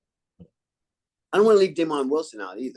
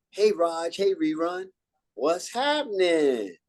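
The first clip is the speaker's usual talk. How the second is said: higher, slow corny delivery of the written line; scripted sitcom greeting; 5 semitones higher, slower than usual. Retold or imitated. Actor mocking the scripted line to his host, higher and slow. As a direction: drag out the line as the script wanted it. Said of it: "the line I was supposed to say was".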